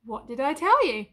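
loud, high gloating; triumphant. Acted. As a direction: crow about being right. Gloating after being proven right.

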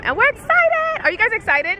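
high, loud excited cheer. Real, not acted. Vlogger hypes kids for show.

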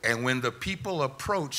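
ordinary talk, nothing marked

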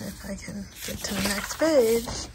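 ordinary delivery

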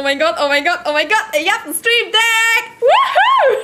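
real delight bursting out; ecstatic cheer. Real, not acted. Streamer thanking a viewer for the gift, squealing with delight.

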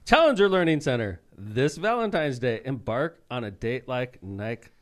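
big radio promo voice; ad read. Pretend. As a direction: belt out the sponsor name, then slow down.

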